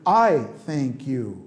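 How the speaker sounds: I thank you said slowly, word by word; slow full form. Shown as a demonstration. Teacher slowly spelling out the full form. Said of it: "Thank you é a forma curta de você dizer".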